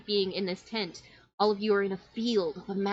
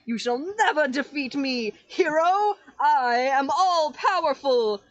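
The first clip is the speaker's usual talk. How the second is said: louder, higher hammy villain taunt; boasting villain; 6 semitones higher, 6 dB louder than usual. Acted. Shadow taunting the hero, hammy villain gloat. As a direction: gloat like a cartoon baddie. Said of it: "and he's like".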